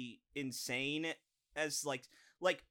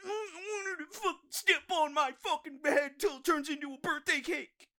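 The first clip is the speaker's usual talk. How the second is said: very high, whiny mocking voice; mocking simp voice; 13 semitones higher, 4 dB louder than usual. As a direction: squeal it like a lovesick fan. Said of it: "people who are like".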